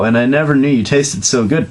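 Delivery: normal talking voice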